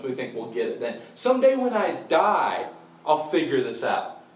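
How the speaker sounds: mock the wishful procrastinator; putting it off. Preacher mimicking people who put faith off, airy procrastinating.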